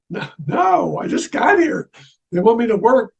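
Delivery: a sudden high cry of disbelief; high-pitched alarmed objection, half shouted; startled protest. Retold or imitated. Protesting the angels' order.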